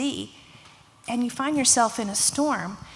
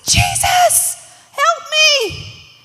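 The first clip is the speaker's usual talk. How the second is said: very high, loud scream for help; acted panic, drawn-out yell; desperate cry; 10 dB louder, much slower than usual. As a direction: shriek it as if sinking. Sinking Peter cries for help.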